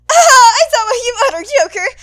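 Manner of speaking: loud, high fake cheer; forced laughing shout. Midnight covering for Aizawa, loud forced cheer. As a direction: cover the awkward moment with noise.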